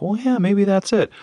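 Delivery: a high, slow, indulgent shrug of a reply; patronizing. Retold or imitated. Lewis brushing off a boy's question, patronizing. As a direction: humor the kid with a lofty brush-off. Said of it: "Lewis kinda gave this patronizing answer".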